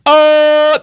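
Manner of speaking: loud, high held "oh"; sudden noise. Acted. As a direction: blurt a sustained high tone. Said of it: "if he goes".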